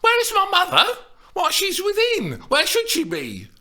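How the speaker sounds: high, loud exasperated yelp; irritated yelp. A narrator's reading. Juliet yelps at her teasing nurse, irritated. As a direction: yelp it, fed up. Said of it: "an irritated yelp".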